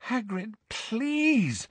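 thin, high desperate appeal. A narrator's reading. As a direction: beg him desperately. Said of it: "a note of real desperation in her voice".

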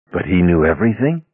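plain reading voice

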